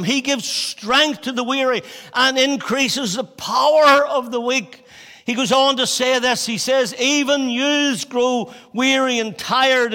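high, loud proclaiming reading; scripture reading preached. Acted. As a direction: proclaim the verses to the congregation. Reads scripture at full force.

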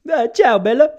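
high, loud hammed-up Italian ciao; Italian accent. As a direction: belt it like a fake Italian. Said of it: "I do this when I'm panicking".

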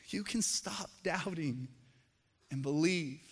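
gentle reassurance. Shown as a demonstration. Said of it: "isn't a rebuke".

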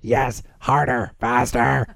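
low croaky lizard-like rasp. Acted. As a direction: purr it like a lizard. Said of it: "she has a lizard voice".

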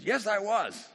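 high, punchy proud declaration; hammed-up brave confession; mock bravado. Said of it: "And vibrato Peter says".

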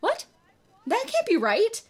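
high, disbelieving protest. A narrator's reading. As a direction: object in disbelief. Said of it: "protested Ginny".

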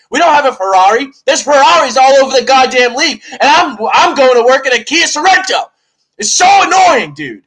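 loud, high, furious car-comparison rant; epic rant. Real, not acted. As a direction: build to a real yell of frustration. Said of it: "That was an epic rant".

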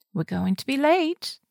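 put-on slow, high London-accented reminder; Rose. A narrator's reading. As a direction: chic Londoner nudging a friend along. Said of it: "her too chic for this place London accent".

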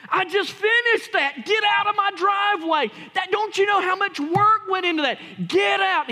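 loud, high, angry shout; yelling at the kid. Retold or imitated. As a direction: bellow at the boy in your driveway. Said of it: "He yelled at the kid".

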